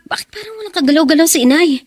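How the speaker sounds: from confused alarm to a desperate scream; panicked discovery building to scream. Acted. Daughter finds her mother dead and screams for her.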